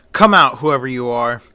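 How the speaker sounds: ordinary delivery, nothing marked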